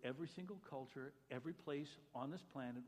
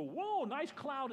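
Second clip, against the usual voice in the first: offhand whoa; 9 semitones higher, 7 dB louder, much slower than usual. Retold or imitated. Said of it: "we look at the creation and go".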